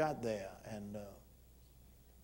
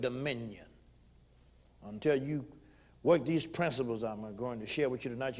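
ordinary delivery

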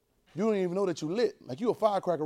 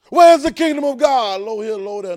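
mock question; 5 semitones higher, 14 dB louder than usual. Preacher mockingly voicing a question.

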